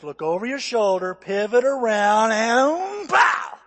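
imitated martial arts teacher, building to a shout; instructor building to shout. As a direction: draw out the wind-up, then explode. Instructor's move building to strike shout.

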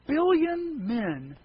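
very slow stretched billion men; drawn-out. Real, not acted. Pastor telling the groom how many men she passed over. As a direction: draw the words out for size.

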